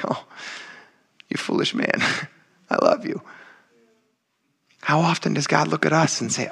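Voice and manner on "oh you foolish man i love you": tender whisper. Retold or imitated. Jesus voiced looking at Peter.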